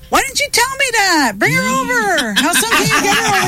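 suddenly thrilled, bring her now; eager excitement. Retold or imitated. Retelling her own eager reply to her friend, high excited.

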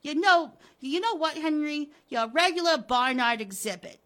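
blistering contempt, hammering every item; vitriolic tirade. Acted. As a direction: hurl each insult at full volume, relishing it. Wilma's vitriolic tirade at Henry.

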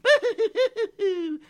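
high, loud boo-hoo crying noise; sad high sobbing. Acted. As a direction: wail like a tiny sad pixie. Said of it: "very sad".

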